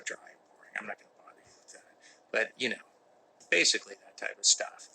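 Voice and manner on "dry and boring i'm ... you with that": fast whispered self-mocking mumble; whispered aside. Real, not acted. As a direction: toss it off under your breath.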